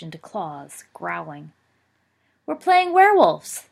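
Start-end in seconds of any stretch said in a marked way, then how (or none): 2.4-3.7 put-on much higher, louder childish giggle-voice; little brother giggles to his parents; giggling boy. A narrator's reading. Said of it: "their younger son giggled".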